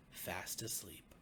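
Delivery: plain reading voice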